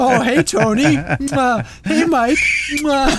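high, put-on puppet greeting; hand-puppet voice. Hand puppets greeting the co-hosts, high mock puppet voice. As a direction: play two puppets blowing kisses, pitched high. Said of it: "He's gonna have two hand puppets".

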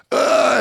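high loud mock grunt; a hammed-up grunt of release; mock climax grunt. Mocking the moment a performer finishes, high loud grunt. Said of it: "you are not gonna think about".

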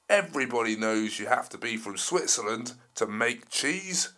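loud roaring dinosaur voice; angry T-Rex roar. Acted. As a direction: bellow like a furious T-Rex. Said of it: "he was so angry".

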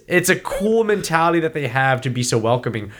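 usual speaking voice

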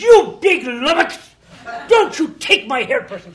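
high, loud mock tirade; furious scolding. [name] storms into the trailer with her script, mock furious yell.

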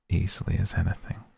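ordinary delivery

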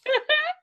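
sharp high laugh; high burst of laughter. Real, not acted. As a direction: squeal with laughter. Laughs at co-host's joke.

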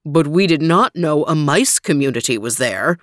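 elephant king. Acted. Elephant King answers the mouse, low and stately. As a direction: speak as a big calm king. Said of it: "said the Elephant King".

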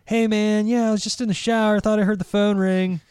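breezy creepy-friendly message, quick and high; Cable Guy voicemail. Retold or imitated. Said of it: "when he was like".

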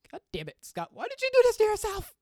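high, loud, laughing self-scolding; teary laughing outburst. Real, not acted. Host mocking himself for tearing up, high laughing yell. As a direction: cry out at yourself through laughter. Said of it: "now I'm getting a little weepy".

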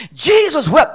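high-pitched, ringing verse; emphatic proclamation. Shown as a demonstration. Preacher announcing the shortest verse, emphatic proclamation.

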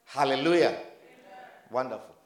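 ordinary delivery

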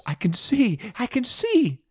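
high, elated cry; excited. A narrator's reading. Healed blind man tells his friends, high-pitched excitement. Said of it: "hear the excitement in his voice".

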